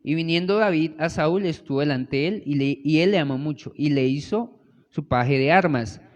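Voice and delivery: scripture reading. Acted. Preacher reading scripture to the congregation, firm and measured. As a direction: read the Bible passage aloud, firm and measured.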